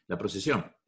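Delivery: usual speaking voice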